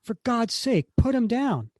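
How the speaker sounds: high-pitched urgent yell. Acted. Cap yells at Sharon across the office, urgent yelling. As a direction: call out high and urgent, in alarm. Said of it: "And he yells".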